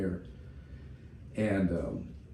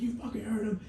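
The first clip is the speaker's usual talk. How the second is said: much higher, harsh screamed order; enraged drill sergeant; 12 semitones higher than usual. Retold or imitated. Drill sergeant ordering recruits to clear out, enraged high yell. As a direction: scream them out of the bay. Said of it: "our drill sergeant's like".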